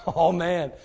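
ordinary talk

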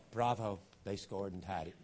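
low and soft, deliberately underwhelmed; mock unexcited fan. Preacher showing how the fan did not react, deadpan. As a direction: report the score as if bored. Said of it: "He got excited".

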